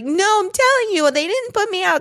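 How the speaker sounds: high whiny insistence; mock high teen protest; insistent daughter. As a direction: squeal like the stubborn kid. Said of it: "she's like".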